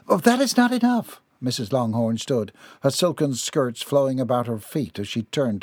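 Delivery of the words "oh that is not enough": high-pitched, upset mother; distraught objection. A narrator's reading. Distraught mother rejects reassurance.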